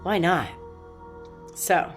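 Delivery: ordinary talk, nothing marked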